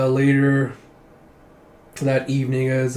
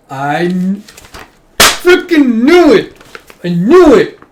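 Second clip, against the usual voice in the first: very high, very loud, stretched-out yell; screaming told-you-so at full volume; ecstatic triumphant shout; 9 semitones higher, 13 dB louder, slower than usual. Real, not acted. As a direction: explode in real vindication. Reader explodes at confirmed theory.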